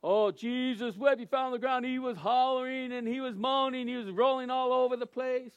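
milk it like a melodramatic storyteller; overdramatized telling. Acted. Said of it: "You're not to say".

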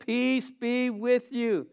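very slow, gentle blessing; slow calm blessing. Acted. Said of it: "He says".

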